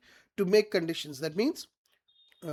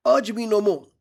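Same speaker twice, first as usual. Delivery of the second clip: Brutus warning Cassius, voice raised louder; 2 semitones higher, 7 dB louder than usual. Acted. Teacher reading Brutus warning Cassius, louder warning. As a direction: push it out loud as a warning to back off.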